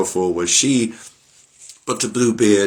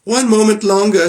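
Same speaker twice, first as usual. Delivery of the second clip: high, loud, desperate plea; wife crying out to Bluebeard for time; frantic plea; 12 semitones higher, 6 dB louder than usual. Acted. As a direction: cry out for more time.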